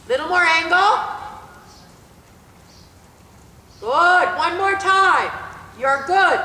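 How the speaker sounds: loud, drawn-out arena commands; brisk projected riding commands. Acted. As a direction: call it out across the arena.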